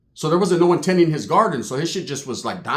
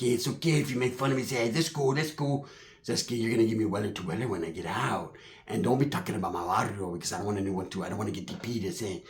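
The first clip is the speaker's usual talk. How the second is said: low, hushed gangster voice; Southsider homie impression; 8 semitones lower, 11 dB quieter than usual. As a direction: imitate a subdued homie, low and quiet. Voicing imprisoned Southsider friend.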